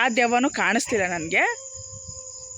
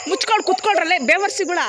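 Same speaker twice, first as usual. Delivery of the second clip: higher, loud order to shut up; angry shout; 6 semitones higher, 5 dB louder than usual. Real, not acted. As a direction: bark the command at the dogs.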